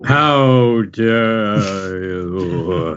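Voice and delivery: very slow, drawn-out, affronted how dare you; a hammed-up, crawling-slow how dare you; dramatic Pound impression. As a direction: stretch every word like an outraged villain. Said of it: "Oh, just like Pound".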